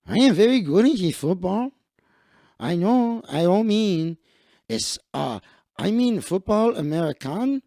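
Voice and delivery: put-on Mexican accent, eager and halting; silly Mexican-accented English with hesitant pauses. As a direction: play an earnest newcomer in a thick Mexican accent. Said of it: "I will put on my Mexican voice".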